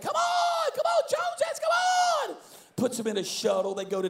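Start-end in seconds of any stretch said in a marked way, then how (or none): 0.0-2.4 very high, hyped host shout; Ty Penny, rallying. Retold or imitated. Imitating the host calling the family out, excited shouting. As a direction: urge them along at full excitement. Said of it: "it's like".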